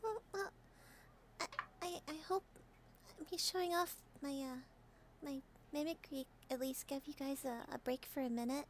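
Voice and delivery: timid. Acted. Miki hopes her mimicry gave the group a break, soft and timid. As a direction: shrink back, unsure of yourself.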